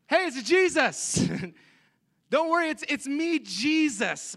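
high, loud, cheery announcement of oneself; jokey casual Jesus. Acted. Preacher jokes how Jesus could greet disciples, loud and jokey. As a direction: call out a big comic hello. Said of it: "it would have been great if you were like".

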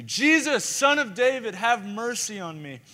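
very loud, high shout; crying out. Retold or imitated. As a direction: holler over the crowd, drawing out the plea. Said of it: "disregarded them completely".

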